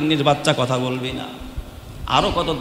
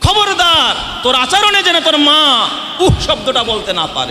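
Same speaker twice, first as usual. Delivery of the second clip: high, loud warning from the pulpit; fiery sermon warning; full-force preaching; 10 semitones higher, 6 dB louder than usual. Acted. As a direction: bellow the warning at them.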